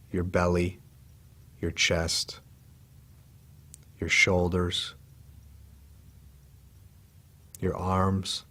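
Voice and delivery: soft, unhurried meditation voice with long pauses; calm, hushed body-scan guidance; guided meditation. Real, not acted. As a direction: guide them gently into relaxing, leaving space after each phrase.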